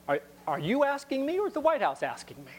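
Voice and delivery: high, pointed mock query; pointed questioning. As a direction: act out a senator pressing the caller on who is asking. Acts out Biden questioning Powell.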